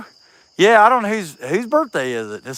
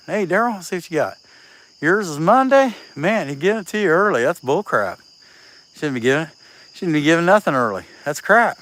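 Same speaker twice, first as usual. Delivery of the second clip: ordinary talk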